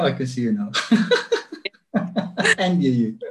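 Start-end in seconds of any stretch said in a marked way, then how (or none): 0.7-1.7 bright high-pitched giggle; a quick high laugh at the glitch. Real, not acted. Laughing at frozen call.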